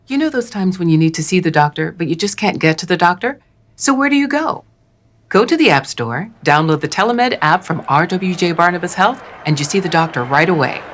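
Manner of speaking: ordinary talk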